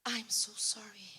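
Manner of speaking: slow whispered apology; acted weepy, whispery regret; tearful apology. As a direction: whisper it slowly, imitating a crying daughter. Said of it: "E começou a chorar".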